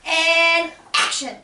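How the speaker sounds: loud, slow "and action"; director call. Acted. Calling the restart like a director.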